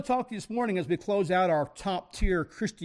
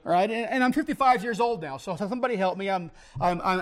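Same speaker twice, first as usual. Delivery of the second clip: normal talking voice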